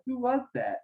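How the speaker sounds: high-pitched imitation of mom asking; squeaky mock mom, puzzled; mom's voice. Son imitating his mom on the phone, high mock voice.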